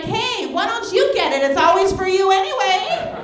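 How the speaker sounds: high, loud mock coworker. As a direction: needle her like a coworker. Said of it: "It's like".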